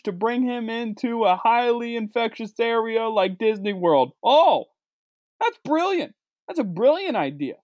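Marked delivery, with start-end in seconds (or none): 4.2-7.6 sarcastic. Real, not acted. Sarcastic praise of bad idea.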